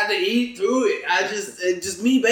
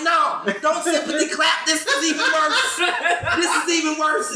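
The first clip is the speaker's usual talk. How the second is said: higher-pitched retold objection with a laugh; mock desperate protest; 9 semitones higher than usual. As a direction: replay your dismay at the pity applause. Retells begging crowd not to clap.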